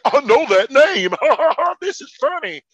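mocking sheeple who laugh at references; dim audience. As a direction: play a clueless viewer cackling. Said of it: "sheeple are like".

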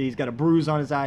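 ordinary delivery

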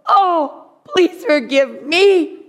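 high, slow, whiny fake plea, laughing through it; mock groveling apology. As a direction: ham up a groveling, tearful sorry. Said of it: "They're probably not gonna do that".

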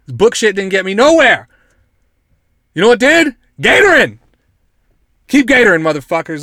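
loud, high, hammed-up battle cry; building to a mock-triumphant yell; rallying holler. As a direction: shout it like an alligator's motto. Alligator's motto, hollered as rallying cry.

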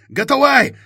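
high, very loud desperate yell; urgent shout. Acted. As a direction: scream at them to get clear. Pilot warns people to flee.

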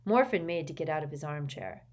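plain reading voice

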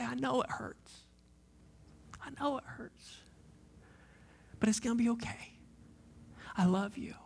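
put-on soft comforting hush to a crying child; tender whisper. Father comforting his hurt child.

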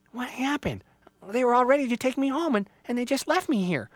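high, dejected little voice; hurt Little Tree. Acted. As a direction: lament being left behind, near tears. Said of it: "so sad she started to cry".

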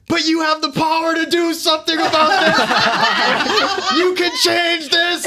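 put-on high, slow, loud drunken appeal through laughter; laughing drunk cry to the king; drunk rallying shout. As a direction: urge the king to act like a tipsy rebel, laughing. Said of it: "I'm smashed".

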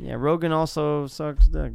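ordinary talk

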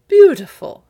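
high, very loud exclamation; exclaiming. A narrator's reading. Father admires the golden rose, exclaiming loudly. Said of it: "exclaimed her father".

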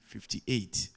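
usual speaking voice

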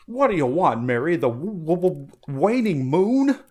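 high, loud stammering Jimmy Stewart. Acted. George Bailey stammering to Mary, loud and high. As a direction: lasso the moon like George Bailey.

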